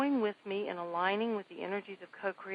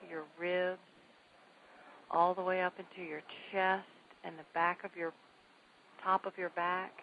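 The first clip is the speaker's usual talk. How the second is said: guided meditation; 6 dB quieter than usual. Acted. Host leading listeners in staged relaxation, calm soft voice. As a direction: guide them gently through the body.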